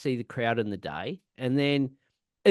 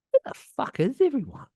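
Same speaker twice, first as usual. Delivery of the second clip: high-pitched mock bewilderment; acted-out incredulous question; baffled exclamation; 12 semitones higher than usual. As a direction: relive it, voice jumping up high in disbelief. Acting out dismay at empty festival.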